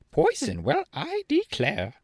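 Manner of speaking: very high, huffy and slow; very high, indignant hen voice. A narrator's reading. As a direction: bristle at the insult like a hen. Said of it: "said Bellina indignantly".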